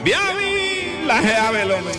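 normal talking voice

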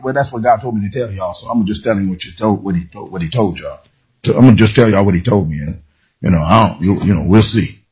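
low, quick, offhand mumble; casual shrug. Retold or imitated. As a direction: toss it off like it's no big deal. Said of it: "he wasn't saying it like".

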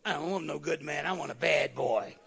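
low, husky mock woman's voice; preacher mimics a sister asking for a bad boy, low and teasing; quoting the sister. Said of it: "the sister told me".

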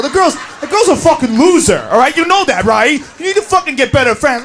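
usual speaking voice

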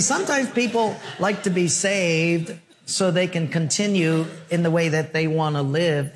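normal talking voice

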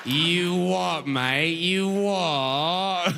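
stretched-out, swooping parody Cockney; mock British accent. Fan parrots a lost British stranger, drawn-out mock British accent. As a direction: savour the British sounds, drawing out each word. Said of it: "They're just making sounds you like".

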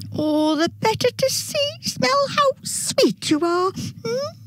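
very high, cooing mock granny; fake grandma voice. A narrator's reading. Dingo sweet-talks the girl as grandma, high fake granny voice. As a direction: coo high like a pretend grandma. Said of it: "trying to sound like a grandma".

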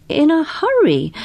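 high, incredulous echo of his words; surprised echo. Acted. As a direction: echo it back in disbelief. Polifax echoes in surprise.

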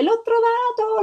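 put-on high, bright cry of a thrilled little girl; shouting with joy. A narrator's reading. Little girl crying out to everyone over her egg, overjoyed. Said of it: "si mise a gridare".

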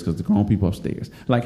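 normal talking voice

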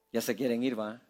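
preacher teasing the restless congregation, low and soft; low teasing aside. Acted. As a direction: mutter it low like a knowing joke. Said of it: "tienen ganas de salir corriendo".

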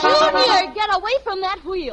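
mother yelling her son away from the bus wheel; sharp scolding. Acted. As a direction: snap at him to get off the wheel. Mother pulling kid off wheel.

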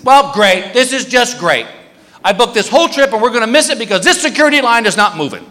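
frantic wife fretting; wife complaining. Retold or imitated. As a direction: mimic an anxious wife, fast. Wife panicking in security line.

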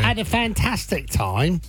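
hammed-up Cockney traveller; mock Cockney accent. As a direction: stretch it out in a playful Cockney accent. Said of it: "came back with a Cockney accent".

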